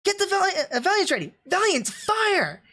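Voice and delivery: hammed-up anime officer ordering fire; imitating Natarle's command. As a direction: call the order like an officer on the bridge. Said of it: "Notaro in English will go like".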